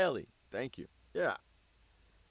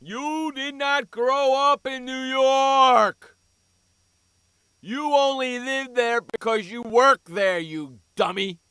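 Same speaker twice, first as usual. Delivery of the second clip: loud, slow, higher-pitched scold; angry scolding; 7 semitones higher, 9 dB louder, slower than usual. Real, not acted. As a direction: tell the co-host off, exasperated. Angry rebuttal to co-host.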